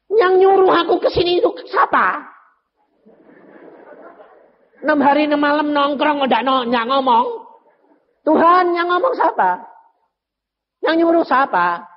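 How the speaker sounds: exasperated complaint. Retold or imitated. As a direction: throw the frustrated question at heaven.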